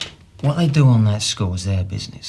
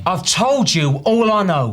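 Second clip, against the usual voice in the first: higher, louder, insistent; exasperated; 8 semitones higher, 4 dB louder, slower than usual. Acted. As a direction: snap it back, fed up. Suspect repeats under pressure.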